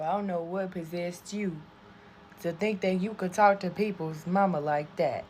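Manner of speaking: usual speaking voice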